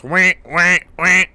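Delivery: loud, high electronic wail; imitated alarm. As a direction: blare it out like a checkpoint alarm. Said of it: "it goes".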